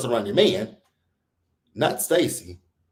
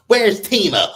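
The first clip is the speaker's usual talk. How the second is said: higher, louder imitation of a nosy question; mock nosy question; 8 semitones higher, 7 dB louder than usual. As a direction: mimic her pestering about Timo. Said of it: "So she's asking Stacey".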